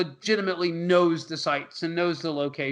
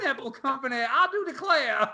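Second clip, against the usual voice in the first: put-on Southern belle accent; 5 semitones higher than usual. Mother finishing her demand, mock Southern belle accent. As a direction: sign off like a prim Southern belle. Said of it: "You gotta be a Southern belle".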